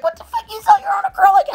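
high rushed shocked question; shocked disbelief. Retold or imitated. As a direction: act out a friend's stunned squeal. Retelling friend's shocked reaction.

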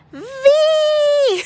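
high, loud excited shout; excited squeal. Real, not acted. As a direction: squeal out the name in delight. First BTS figurine revealed.

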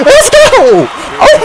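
high-pitched screaming celebration; real fan elation, voice way up; ecstatic cheering. Real, not acted. Celebrating as victory nears.

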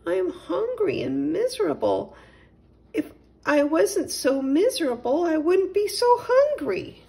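high, tearful whining cat voice; miserable hungry cat. Acted. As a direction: whimper it like a self-pitying cat close to tears. Katundra laments her hunger.

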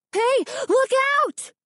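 a very high, drawn-out warning cry; a stranger yelling to warn of danger; alarmed shout. Acted. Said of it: "an alarmed voice cried".

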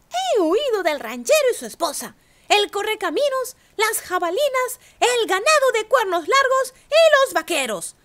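high, proud bragging; boasting. A narrator's reading. Gingerbread cowboy bragging to the coyote, high boast. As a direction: brag about every escape. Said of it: "se jactó".

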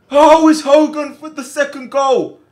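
yelled high and loud at a goal; excited shout. Real, not acted. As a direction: erupt like a fan seeing the net bulge. Goal celebration in game.

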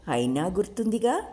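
ordinary delivery

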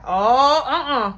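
loud, high outraged oh; shocked uh-uh. Retold or imitated. Lala coming out and seeing Tracy's arms around her, loud and shocked. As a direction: catch them in the act and object out loud.

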